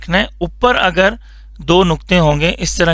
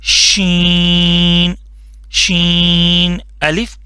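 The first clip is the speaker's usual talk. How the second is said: slow letter demo; much slower than usual. Shown as a demonstration. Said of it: "اور یہ ہو گیا شین".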